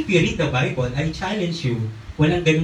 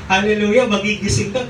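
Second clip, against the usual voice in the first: usual speaking voice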